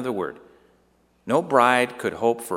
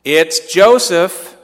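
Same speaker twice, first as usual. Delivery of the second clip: a loud, slow, harsh call, much higher; 9 semitones higher, 14 dB louder, much slower than usual. Acted. Mary's mother calling into the house, harsh loud call. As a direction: spit the name out across the house. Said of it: "Loud, harshly".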